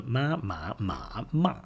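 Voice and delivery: each "ma" on a different tone; vary the pitch contour on every "ma"; Chinese tones. Shown as a demonstration. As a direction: Mandarin tone drill on "ma". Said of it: "Rising, falling, level".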